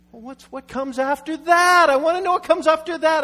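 fed-up driver yelling at the sat-nav; exasperated outburst. Retold or imitated. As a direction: burst out at the GPS in frustration. Pastor demanding what comes next.

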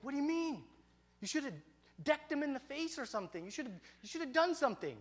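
high-pitched disbelief; acted-out frustrated kid urging action; indignant protest. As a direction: object in outrage, pitched high.

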